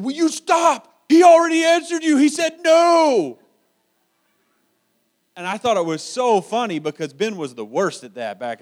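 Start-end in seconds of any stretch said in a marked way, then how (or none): 0.0-3.4 put-on older boy snapping loudly at a younger one; exasperated shout. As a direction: yell at the little one to quit begging.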